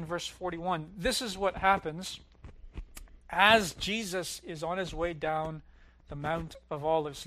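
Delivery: usual speaking voice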